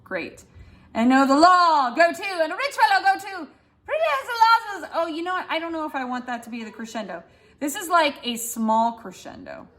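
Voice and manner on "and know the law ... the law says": high, speeding up; accelerating boast; faster and faster. Shown as a demonstration. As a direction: rattle it off quicker each phrase. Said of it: "faster and faster and faster".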